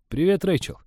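bright, eager greeting; shy young man greeting. Acted. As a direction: greet her a little too eagerly. Greeting the waitress he likes.

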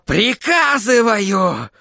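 high, drawn-out cry; furious command. A narrator's reading. Sorcerer ordering crystal to obey, furious.

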